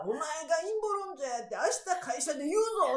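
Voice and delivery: put-on like a kid threatening to tell; childish threat. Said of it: "とか".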